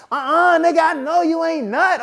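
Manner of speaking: high-pitched, sassy disbelief; mock accusing girlfriend. Girlfriend calls him out in story.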